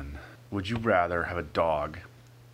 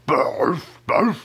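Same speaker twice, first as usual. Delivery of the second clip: gruff dog bark; 6 semitones higher, 9 dB louder than usual. Pretend. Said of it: "the next time it'd be like".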